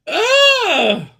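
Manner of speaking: high, loud cry of disbelief; shocked yelp. Real, not acted. Streamer overwhelmed by his own joke, yelping loudly. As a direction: let out a sharp yelp. Laughing outburst after the joke.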